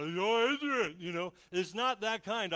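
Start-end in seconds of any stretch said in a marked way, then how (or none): 0.0-0.9 high loud mock holler of Adrian; hollered Rocky. Preacher imitates Rocky hollering for Adrian, loud. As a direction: call out Adrian like a battered boxer. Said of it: "hollering out".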